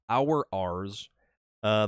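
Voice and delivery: normal talking voice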